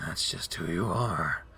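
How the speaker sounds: low, slow deadpan. Acted. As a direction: drag it out flat and low. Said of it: "in that same deadpan voice".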